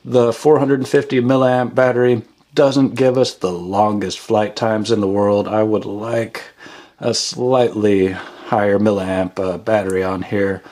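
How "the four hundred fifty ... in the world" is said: normal talking voice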